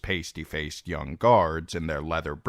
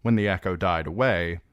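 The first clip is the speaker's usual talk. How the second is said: usual speaking voice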